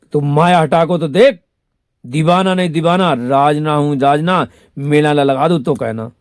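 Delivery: half-sung, loud and high film-line declaration; loud filmy quote. Retold or imitated. Quoting his promise to followers, loud half-sung boast. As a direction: belt it like a hero's boast.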